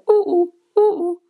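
high, loud falling hoots; wordless cuckoo call. Shown as a demonstration. Joke teller shows how the bird really sounds, cuckoo hooting. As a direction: hoot the two-note cuckoo cry. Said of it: "er sagt eher so".

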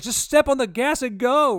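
loud, high yell to hit the gas; urgent shout. Retold or imitated. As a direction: bark the order at the driver. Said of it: "I was like".

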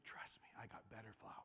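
soft, fast gentle whisper; whispered shepherd. Pretend. Shepherd reassuring the sheep, soft fast whisper. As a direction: whisper the promise close. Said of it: "The shepherd's like".

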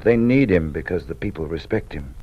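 ordinary delivery

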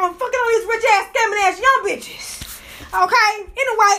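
ordinary talk, nothing marked

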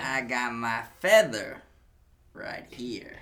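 slow hammy bandit threat; mock outlaw voice. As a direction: menace with a feather.

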